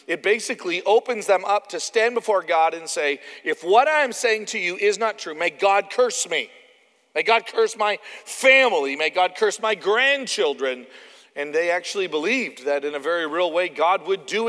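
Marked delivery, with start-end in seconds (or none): 3.4-10.9 loud, emphatic self-curse; preacher acting out a vow; solemn oath. Acts out calling down God's curse.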